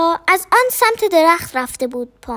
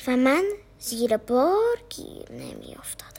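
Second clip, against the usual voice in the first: whisper; 4 semitones lower, 7 dB quieter than usual. A narrator's reading. Whispered complaint.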